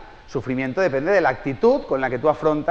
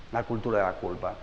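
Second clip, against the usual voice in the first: "La cultura de la culpa" repeated much lower and softer; soft echo; 7 semitones lower, 8 dB quieter than usual. Shown as a demonstration. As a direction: let the phrase sink in quietly. Repite la frase en eco. (eco suave y bajo de "La cultura de la culpa")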